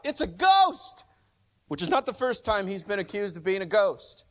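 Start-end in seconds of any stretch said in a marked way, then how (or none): 0.0-1.0 high, loud, slow terrified shout; frightened cry. Acted. Disciples crying out in fear at the figure on the water. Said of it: "they cried out".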